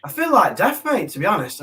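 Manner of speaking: normal talking voice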